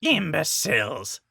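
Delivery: slow, grumbled insult; grumbling. A narrator's reading. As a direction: grumble it in disgust. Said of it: "Rover grumbled".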